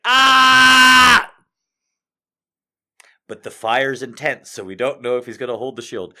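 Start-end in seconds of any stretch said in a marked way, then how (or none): 0.1-1.3 high, loud mock battle cry; hammed-up hero scream. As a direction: scream as the fire hits. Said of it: "He's like".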